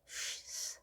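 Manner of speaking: 쉬 hissed as a long voiceless sh; a whispered shh demo; whispered sh sound. Shown as a demonstration. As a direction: breathe out 쉬 with no voice, like hushing. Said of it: "S에서 파생된 소리로서 쉬".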